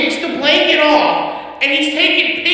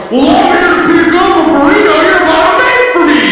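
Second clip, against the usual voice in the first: raised, high-pitched customer argument; yelled retort, higher than usual voice; imitating irate customer; 6 semitones higher, 4 dB louder than usual. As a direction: argue loudly with the manager at the window. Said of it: "Buddy is yelling at the manager".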